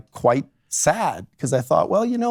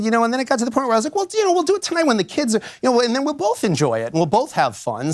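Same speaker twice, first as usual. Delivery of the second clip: higher, lightly voiced wife remembering; lightly voiced client; 11 semitones higher, faster than usual. Lawyer retells a client describing her marriage, higher voice. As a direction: speak as the wife, a little higher. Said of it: "she said".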